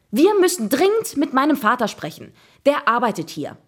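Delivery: usual speaking voice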